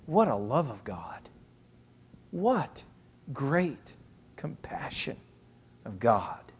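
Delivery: soft, word by word, fading to a whisper; hushed awe. Real, not acted. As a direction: marvel quietly, pausing between the words. Preacher marvelling at God's compassion.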